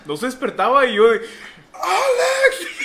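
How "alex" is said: very high, loud scream of a name; piercing high call; retold loud yell. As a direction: yell to wake a sleeping brother. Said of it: "Yo le grité fuerte".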